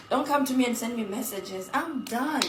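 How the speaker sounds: ordinary talk, nothing marked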